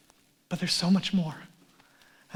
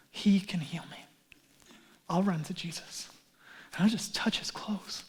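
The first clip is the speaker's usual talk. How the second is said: hushed, softer inner voice of quiet faith; whispered hope; 2 semitones lower, 7 dB quieter than usual. Acted. The sick woman resolving to touch Jesus, whispered. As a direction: breathe it like a secret prayer.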